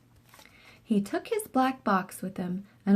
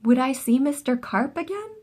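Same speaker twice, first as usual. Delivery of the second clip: plain reading voice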